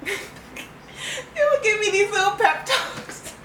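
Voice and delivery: words broken up by laughter; laughing. Real, not acted. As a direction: laugh while you tell it. Laughing through the story.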